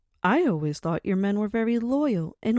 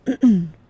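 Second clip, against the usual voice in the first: throat clear; 10 dB louder than usual. Acted. Madam clears throat for attention.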